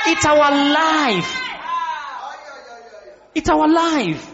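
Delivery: high, drawn-out declaration; rousing proclamation. Real, not acted. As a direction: proclaim it to the whole room. Preacher rousing the congregation.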